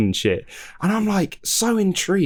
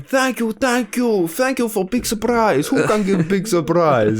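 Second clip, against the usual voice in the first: much higher, slow mock Russian accent, thanking for donations; hammed-up Russian streamer thanking his fans, pitched way up; 10 semitones higher, slower than usual. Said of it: "he keeps going".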